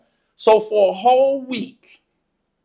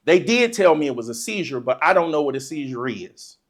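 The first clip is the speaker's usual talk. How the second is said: usual speaking voice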